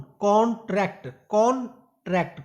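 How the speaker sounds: Contract with the stress on कॉन, drawn out slowly; first syllable stressed. Shown as a demonstration. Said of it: "कौन पे focus किया गया". (पहले syllable पर ज़ोर)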